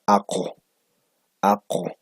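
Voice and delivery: "ako" with the u opened toward an o. Shown as a demonstration. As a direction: say "ako" as if the u were an o, pushed back by the posvelar k. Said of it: "pronuncio esta U como si fuera O".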